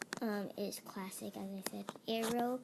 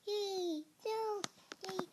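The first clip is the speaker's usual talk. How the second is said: excited squeal; 10 semitones higher, much slower than usual. Real, not acted. Game near-kill reactions.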